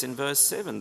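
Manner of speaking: ordinary delivery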